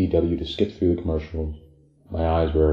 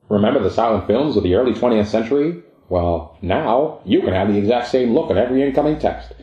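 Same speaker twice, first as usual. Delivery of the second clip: put-on higher, louder old-time radio pitchman; smiling vintage radio announcer; old-timey voice; 5 semitones higher, 4 dB louder than usual. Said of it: "With a smile and an old-timey voice, he begins his spiel".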